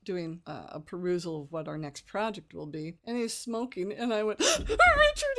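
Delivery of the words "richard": very high, loud acted gasp-out; panicked cry. Receptionist bursting into the boss's office, panicked cry. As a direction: call out in mock desperation.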